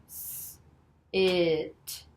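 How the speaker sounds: long hissed 'S', then stretched 'It'; slow blend. Shown as a demonstration. Teacher blending for Jim, slow 'S. It'. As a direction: draw out 'S. It' sound by sound. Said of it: "say 'em all together slowly".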